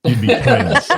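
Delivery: said through a laugh. Real, not acted. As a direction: chuckle the words out. Host jokes with guest.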